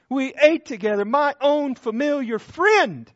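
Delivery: loud, ringing pulpit cadence, each phrase hammered; full-force preaching. Acted. Pastor to a hurting congregation, loud impassioned preaching. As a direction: preach it out over the whole church.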